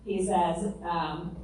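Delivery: usual speaking voice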